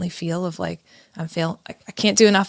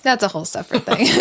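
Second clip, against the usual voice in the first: laughing; 5 semitones higher, 4 dB louder, faster than usual. Real, not acted. Repeating cohost's joke, laughing.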